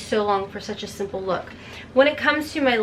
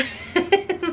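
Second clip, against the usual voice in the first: short, higher-pitched laugh; light laugh; 7 semitones higher than usual. Real, not acted. Laughs at own palette dilemma.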